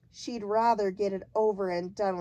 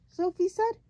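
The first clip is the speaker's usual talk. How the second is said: plain reading voice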